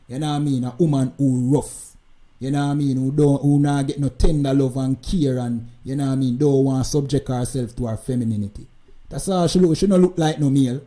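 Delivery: ordinary talk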